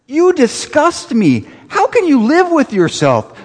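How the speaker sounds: disgusted. Retold or imitated. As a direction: play an outraged elf. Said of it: "Buddy's disgust".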